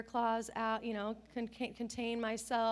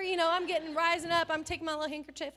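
normal talking voice

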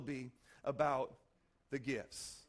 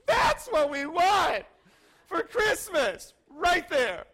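loud, slow, high pleading yell for the toy; excited kids' shout; 7 semitones higher, 8 dB louder, much slower than usual. Retold or imitated. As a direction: shout it out like a kid who has to have it. Kids begging parents for toys.

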